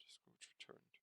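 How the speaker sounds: ordinary delivery, nothing marked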